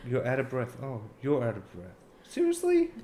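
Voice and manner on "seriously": incredulous. Real, not acted. Incredulous at friend.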